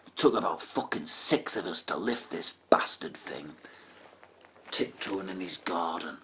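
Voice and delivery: soft conspiratorial whisper; sneaky whisper. Retold or imitated. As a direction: whisper like you're tiptoeing past him. Sneaking the bath into garden.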